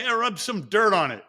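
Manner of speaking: brisk, tough-love dad; gruff coach dad. Retold or imitated. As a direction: toss it off like a ballfield dad. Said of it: "You say".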